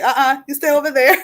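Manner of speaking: sassy little keep-out order; playful warding off. Pretend. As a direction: playfully tell someone to keep back. Playfully shooing away at closed doors.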